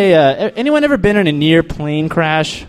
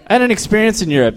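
normal talking voice

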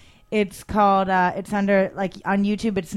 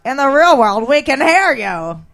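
louder, higher voice of a comic snapping at hecklers; club comic; 6 semitones higher, 8 dB louder than usual. Retold or imitated. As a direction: call back to a heckler from the stage. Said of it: "and he's like".

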